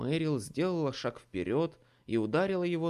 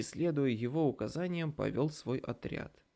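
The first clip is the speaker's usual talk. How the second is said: plain reading voice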